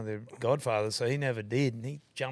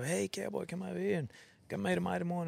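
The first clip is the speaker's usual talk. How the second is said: higher-pitched, softened mock call; imitated calling out; 7 semitones higher, 5 dB quieter than usual. Passer-by calls out to him, acted.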